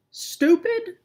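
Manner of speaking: roaring. Acted. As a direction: bellow the insult back at her. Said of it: "roars the bearded raven".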